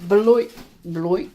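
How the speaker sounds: mock flush sound on Beloit; toilet plop Beloit. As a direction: mimic a splash into the toilet. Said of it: "that's all I hear is dropping a deuce".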